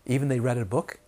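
ordinary talk

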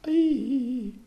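high, loud squeal of bliss; blissful squeal. Shown as a demonstration. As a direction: let out a sudden delighted "Ee". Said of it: "you just touch like that and go".

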